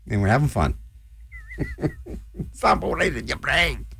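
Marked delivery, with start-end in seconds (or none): 1.5-2.5 breathless high cackle. Acted. DJ cackles after rant.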